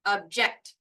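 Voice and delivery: "object" as ub-JECT, the end emphasized; stress on second syllable. Shown as a demonstration. Teacher repeats second version for learners, stress on second syllable of "object". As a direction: hold off, then hit JECT in "object". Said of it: "The second one".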